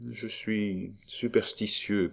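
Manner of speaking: slow, soft, musing delivery; hushed and thoughtful, drawn out; pensive. A narrator's reading. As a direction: muse it slowly, half to oneself. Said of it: "poursuivit Roland d'un air pensif".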